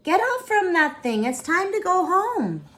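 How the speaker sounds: high, loud calling out; grandma calling. Acted. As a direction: call her off the carousel. Grandma calls child off ride.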